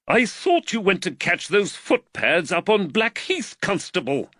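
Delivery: high-pitched, incredulous exasperation; exasperated telling-off, pitched right up; exasperated magistrate. A narrator's reading. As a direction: scold the constable in exasperation. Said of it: "said the exasperated magistrate".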